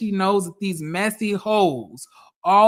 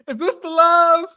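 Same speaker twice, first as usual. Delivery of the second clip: outraged shout; 9 semitones higher, 6 dB louder than usual. Real, not acted. Repeats outraged question.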